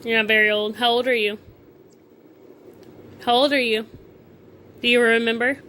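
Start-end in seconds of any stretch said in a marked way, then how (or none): none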